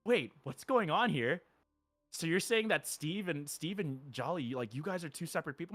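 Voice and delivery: put-on confused child. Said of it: "she's just like".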